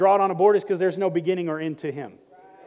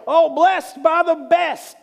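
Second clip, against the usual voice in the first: high, slow, sing-song sweetness; bright mock church-lady greeting; mock churchy greeting; 9 semitones higher, 5 dB louder, slower than usual. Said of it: "We say things like".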